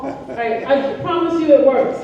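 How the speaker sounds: normal talking voice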